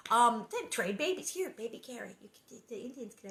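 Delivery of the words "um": ordinary delivery, nothing marked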